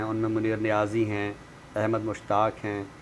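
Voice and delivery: ordinary talk, nothing marked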